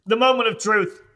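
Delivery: high, loud mock-suspense proclamation; dramatic announcement. Co-host hyping the test result, dramatic announcement. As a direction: proclaim it like a big reveal.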